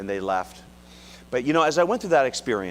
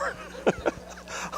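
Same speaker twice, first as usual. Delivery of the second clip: laugh; 7 dB quieter than usual. Real, not acted. Laugh at wife's wish.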